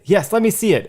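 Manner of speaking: put-on high, eager childlike yes; little daughter voice. Dad voices his daughter begging to see the deer. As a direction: squeak it out like an excited toddler.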